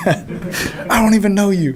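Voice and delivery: high, slow, laughing mock indignation; laughing, stretched-out retold disbelief; incredulous comeback. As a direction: draw it out high, amused and offended. Retold retort to yelling coach.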